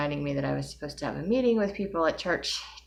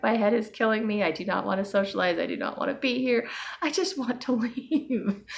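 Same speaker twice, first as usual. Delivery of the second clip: higher, whiny complaint; inner whine; 6 semitones higher than usual. Retold or imitated. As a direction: grumble to yourself in misery.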